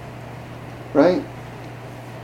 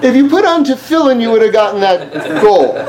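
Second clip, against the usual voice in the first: higher-pitched, laughing through the words; laughing mock scolding; 5 semitones higher than usual. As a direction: crack up while acting out a silly reproach. Jokes in acted quote while laughing.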